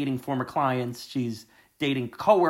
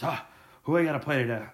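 hungover Hugh Grant; 3 semitones higher than usual. Retold or imitated. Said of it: "an actor who kinda just wakes up hungover and goes".

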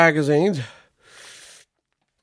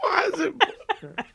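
laughing; 12 semitones higher, 6 dB quieter than usual. Real, not acted. Laughing at silly promo code.

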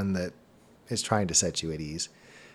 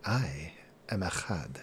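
slow, very soft, low introduction; name yourself slowly, hushed and deep; low-voiced stranger; 4 semitones lower, 10 dB quieter, slower than usual. Mysterious stranger introduces himself to travellers, slow low voice.

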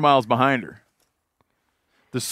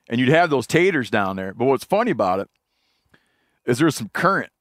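normal talking voice

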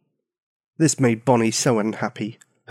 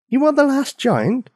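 put-on surprised little girl; 12 semitones higher than usual. Girl asking the giant in surprise, high. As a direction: ask it high in disbelief.

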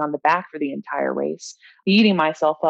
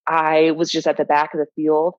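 ordinary delivery

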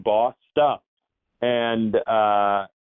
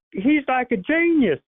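much higher, hammed-up country fan gushing; mock country fan raving about a genius; thick country accent. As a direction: do the starstruck country fan at full tilt. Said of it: "a super thick country accent".

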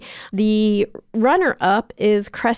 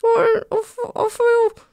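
tiny high squeaky voice; 11 semitones higher than usual. Acted. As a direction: speak like a small trapped creature. Kevin trapped inside.

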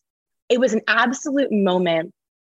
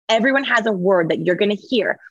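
ordinary talk, nothing marked

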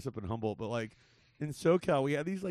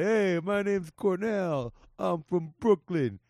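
slow, higher-pitched hammed-up stand-up catchphrase; mock Brooklyn Italian comic; 5 semitones higher, 5 dB louder, much slower than usual. Podcaster pitches a stand-up catchphrase to co-host, hammed-up Brooklyn Italian act. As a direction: draw it out like a hammy Italian-American stage intro, stretching the last word. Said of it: "that should be your catchphrase".

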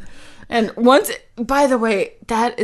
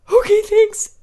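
high startled thanks; 12 semitones higher, 5 dB louder than usual. Retold or imitated. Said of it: "And I was like".

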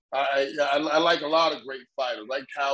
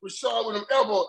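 ordinary talk, nothing marked

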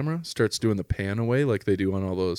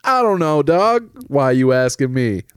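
louder and higher, exasperated; loud incredulous retort; 3 semitones higher, 5 dB louder than usual. Retold or imitated. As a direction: bark it like a fed-up shopper. Own reply acted loud.

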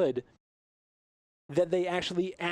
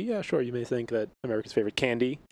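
ordinary talk

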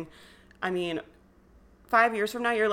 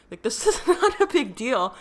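laughing through the words, louder and higher; laughing reassurance; 5 semitones higher, 6 dB louder than usual. Real, not acted. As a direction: laugh it off to show it's nothing. Laughing off friend's worry.

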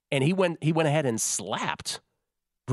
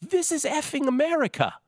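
put-on British accent. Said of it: "in a louder British accent".

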